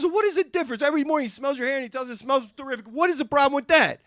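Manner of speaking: high-pitched, baffled protest; acted incredulous questioning. Boss asks woman what the problem is, incredulous. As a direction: question the complaint as if it makes no sense.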